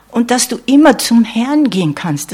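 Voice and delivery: immer hit hard and loud; stress on immer. Real, not acted. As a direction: punch the word immer, falling after it. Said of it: "das muss ich auch betonen". (Betonung auf „immer“, laut und kräftig)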